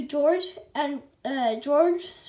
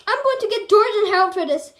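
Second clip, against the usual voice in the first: fast, louder, higher threat; angry scream; 6 semitones higher, 7 dB louder, faster than usual. A narrator's reading. Principal screaming a threat about the boys, fast and loud. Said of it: "Mister Krupp screamed".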